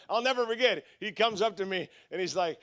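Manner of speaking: ordinary talk, nothing marked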